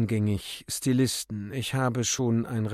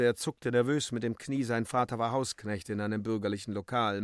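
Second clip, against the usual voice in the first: plain reading voice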